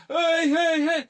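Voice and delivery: loud, very high-pitched whooping greeting; imitated party cheer. Housemates greeting the new model at the door, mimicked cheer. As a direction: cheer like a rowdy bunch of housemates.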